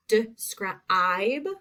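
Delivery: "describe" split as de-scruh-IBE; sounded out. Shown as a demonstration. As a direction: stretch the long I in "describe" for listeners. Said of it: "I'm gonna say the words first".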